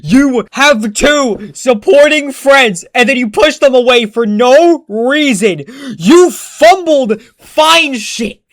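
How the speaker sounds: real fed-up venting; exasperated rant. Real, not acted. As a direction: lecture him like a fed-up friend. Ranting at the character.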